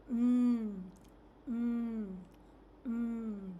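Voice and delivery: "Mm" hummed loudly through the nose; nasal m sound. Shown as a demonstration. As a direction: hold the "Mm" with closed lips. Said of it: "the sound is produced nasally".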